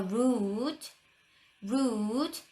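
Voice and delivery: rude with a long rounded oo. Shown as a demonstration. Teacher modeling the long u sound. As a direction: stretch the oo in rude slowly. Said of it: "우 발음 날 때가 있어요".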